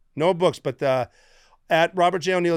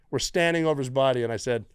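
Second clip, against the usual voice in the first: usual speaking voice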